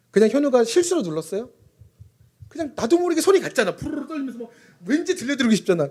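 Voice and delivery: put-on high, eager boyish voice; playful child voice. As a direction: act out the son's giddy excitement. Imitates son's excited feelings. (들뜬 아이 흉내)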